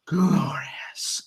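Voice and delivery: soft, breathy-free hushed drawl of the word; a quiet, sly taunt; mild mock gloat. Mimicking Roode's catchphrase to Nakamura, softly mocking. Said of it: "he's like".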